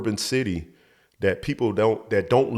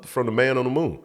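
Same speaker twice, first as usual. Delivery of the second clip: normal talking voice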